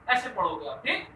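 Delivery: ordinary delivery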